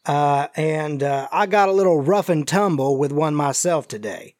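folksy Southern stranger, choosing words carefully; injured Southern stranger. Acted. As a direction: play a cagey, folksy Southerner hinting at danger. GM voices the injured man.

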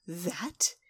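slow, halting whisper of disbelief; stunned whisper. Acted. Trixie stunned by the zaps.

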